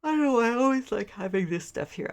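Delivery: words stretched out by a yawn. Real, not acted. Tired vlogger yawns mid-sentence after staying up late, talking through it. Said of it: "I'm sorry for yawning".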